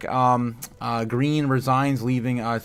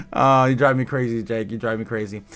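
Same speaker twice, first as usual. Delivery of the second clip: ordinary delivery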